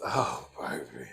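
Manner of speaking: low, slow whisper. Real, not acted. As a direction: whisper it with relish. Relishing the next gossip.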